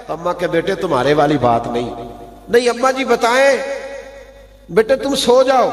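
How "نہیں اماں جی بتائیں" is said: put-on high, insistent child's plea; child begs his mother to tell him, high and pleading. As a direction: beg your mother like a small child. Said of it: "بچے پوچھے".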